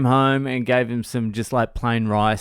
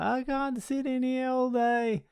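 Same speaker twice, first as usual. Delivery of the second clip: mock crying; 6 dB quieter than usual. Son stuck in bed protests to dad, mock crying wail. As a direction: imitate a kid bawling in protest. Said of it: "he really starts crying".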